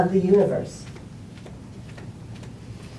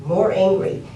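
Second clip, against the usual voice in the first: usual speaking voice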